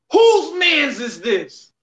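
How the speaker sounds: high, loud, slow-stretched yell; catchphrase shout. Shown as a demonstration. Cash giving the catchphrase a shot, drawn-out shout. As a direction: yell it out long, as an impression. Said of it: "you wanna give it a shot".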